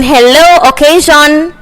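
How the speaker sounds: ordinary talk, nothing marked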